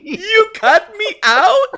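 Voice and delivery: high, loud, slow disbelieving cry; hammed-up shock at being cut out; outraged disbelief. Retold Selene's outrage.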